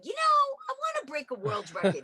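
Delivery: mock eager self. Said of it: "me going".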